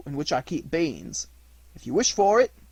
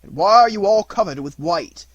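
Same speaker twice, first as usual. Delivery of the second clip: loud, high startled demand; abrupt old man's exclaimed question; sudden question; 6 semitones higher, 11 dB louder than usual. A narrator's reading. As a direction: burst out asking, voice raised. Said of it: "asked the old man suddenly".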